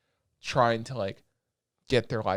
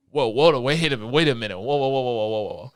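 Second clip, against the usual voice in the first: shocked whoa; 6 dB louder than usual. Retold or imitated. Reacting to the prince's line.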